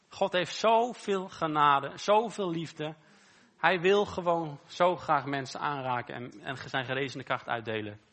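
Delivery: slow, loud, lifted preaching; fervent preaching. Acted. Preacher to the congregation about grace, slow and loud. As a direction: swell it out to the congregation, slowly and loudly.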